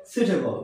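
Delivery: সুইটেবল said "soo-te-bol"; no w glide in সুইটেবল; সুইটেবল starting with plain "soot"; correct pronunciation. Shown as a demonstration. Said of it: "কিন্তু উচ্চারণটা হবে".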